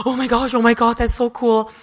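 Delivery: gasp, then loud starstruck gushing; mock fangirl excitement with a gasp; excited gushing. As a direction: act out a thrilled reaction, loud. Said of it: "be exciting telling her".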